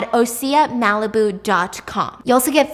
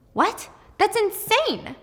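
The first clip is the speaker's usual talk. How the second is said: shocked disbelief; 10 semitones higher, slower than usual. Retold or imitated. Shocked reaction to police suspicion.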